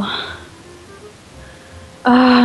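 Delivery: ordinary talk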